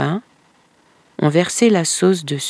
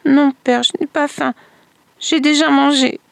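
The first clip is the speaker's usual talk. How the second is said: a lightly voiced girl, higher, hesitant refusal; frightened girl refusing; 9 semitones higher than usual. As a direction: stammer a refusal like a scared child, higher. Girl refuses father's offer.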